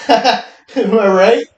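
slow, high, laughing; laughing drawn-out. Acted. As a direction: stretch it out through a laugh. Hammed laughing tag.

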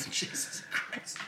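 unvoiced, breathy gasp; breathless whisper. Real, not acted. After a too-strong drink.

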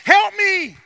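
high, loud plea for help; acted prayer cried from the pulpit; crying out. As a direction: call on Jesus as if desperate. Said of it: "come in here saying".

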